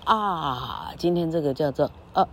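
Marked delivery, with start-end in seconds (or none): 0.0-0.9 啊 drawn out wide and open; full long "ah". Shown as a demonstration. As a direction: stretch 啊 into a long, falling "aah". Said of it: "六号母音叫做啊，".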